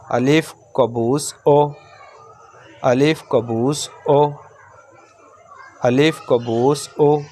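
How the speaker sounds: "अलिफ कबूस ओ" read slowly, ending on a short "ओ"; slow reading drill. Shown as a demonstration. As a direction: spell it out slowly for the class, short final "ओ". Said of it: "short में". (धीरे-धीरे "अलिफ कबूस ओ", आख़िरी "ओ" छोटा)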